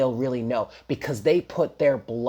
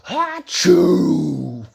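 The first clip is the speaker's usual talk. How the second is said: loud, higher mock sneeze; huge cartoon sneeze; 5 semitones higher, 8 dB louder than usual. Imitating a little cartoon mouse that sneezes, huge mock sneeze. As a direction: blast out an exaggerated achoo. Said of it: "make these huge sneeze".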